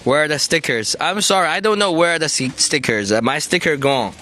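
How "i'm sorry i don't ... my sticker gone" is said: ordinary talk, nothing marked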